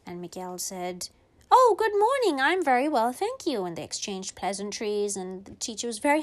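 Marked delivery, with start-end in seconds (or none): 1.5-3.7 higher polite little greeting; polite child. Retold or imitated. Miguel greeting the pretend little brother, polite child. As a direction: answer sweetly.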